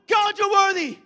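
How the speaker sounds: high, shouted praise; exuberant group. Acted. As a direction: belt it out to God. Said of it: "Then you got another one".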